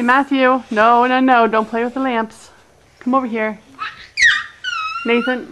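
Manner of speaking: normal talking voice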